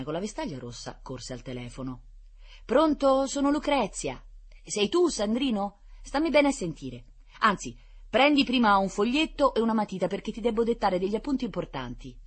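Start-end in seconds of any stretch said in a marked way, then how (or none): 2.6-12.2 high, louder instructing voice; bossy girl on phone. A narrator's reading. Lucrezia calling brother Sandrino to take notes, bossy. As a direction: give orders down the line, high-pitched.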